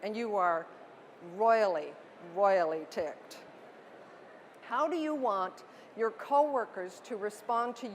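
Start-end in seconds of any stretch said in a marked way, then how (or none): 1.4-3.4 royally repeated, louder the first time, slower the second; stress on royally. Shown as a demonstration. Imagining a wrecked car and a missed meeting, emphatic stress on royally. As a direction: lean hard on royally, royally ticked. Describing anger after car crash.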